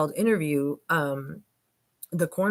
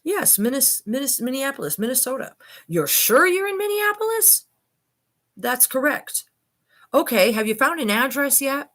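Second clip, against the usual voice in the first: voicing the dispatcher; 8 semitones higher, 3 dB louder than usual. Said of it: "Emily says".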